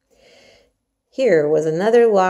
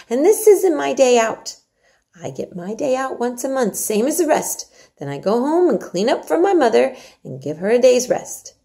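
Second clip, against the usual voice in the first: Yorkshire maid Martha; 7 semitones higher, 4 dB louder than usual. Acted. Martha urges Mary to eat her porridge, hearty and coaxing. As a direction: coax the child like a bossy country servant.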